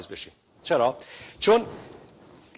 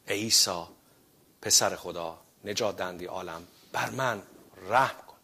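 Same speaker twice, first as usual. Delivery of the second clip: much softer, lower, humble prayer «ای عیسی»; prayed with faith; 5 semitones lower, 6 dB quieter than usual. Acted. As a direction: pray it quietly as if alone with God. (دعای آرام و فروتنانه با ایمان)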